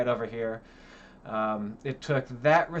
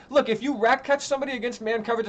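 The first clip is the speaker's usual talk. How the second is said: ordinary talk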